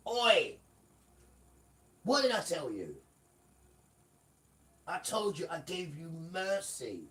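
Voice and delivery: loud, high angry roar; Father's furious shout. Retold or imitated. Father shouting Satan away from his servant, loud. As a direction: thunder at Satan like an outraged father.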